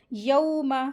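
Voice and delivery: यौमा with a smooth au glide; soft leen glide. Shown as a demonstration. Teacher models leen letter, smooth gliding au in यौमा. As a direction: glide gently through the au of यौमा. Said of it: "इसको नरमी के साथ पढ़ेंगे".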